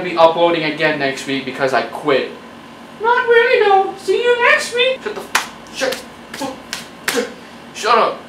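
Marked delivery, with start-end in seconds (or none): 3.0-5.0 high squeaky falsetto voice; back talking. Acted. Creator's back voice reassuring viewers, high falsetto. 5.0-8.2 unvoiced whispered shut; whispered shush. Creator whispering to silence his own back.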